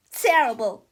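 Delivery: loud, sulky answer; grumpy retort. Acted. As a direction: snap it at your dad. Melvin answers father.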